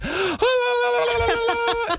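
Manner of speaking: high-pitched burst of pent-up babble; imitated noise outburst. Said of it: "she just starts making noise".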